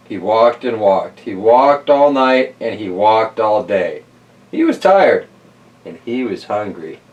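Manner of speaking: lively read-aloud storyteller voice; story narration. Acted. Host reading Stone Soup to kids, storytelling voice. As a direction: tell it like a bedtime picture book. Reads picture book aloud.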